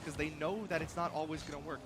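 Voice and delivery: ordinary talk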